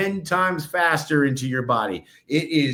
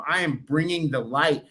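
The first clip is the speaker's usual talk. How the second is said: ordinary talk, nothing marked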